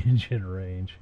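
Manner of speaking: ordinary talk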